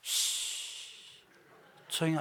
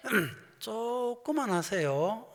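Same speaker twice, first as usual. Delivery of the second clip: 길게 늘인 시범; 4 semitones higher, much slower than usual. Shown as a demonstration. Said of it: "미얀마로 말로 냈네 막".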